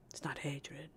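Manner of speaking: unvoiced aside whisper. Real, not acted. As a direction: tuck it away as an aside. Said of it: "whispered aside".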